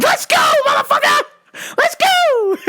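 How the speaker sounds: very high, loud screeching challenge; hammed-up battle cry, spoiling for a fight; hyped-up shout.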